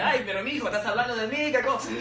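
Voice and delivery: laughing imitation of his mother; Spanish-speaking mother teasing her son, imitated; Cuban mom. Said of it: "My mom's like".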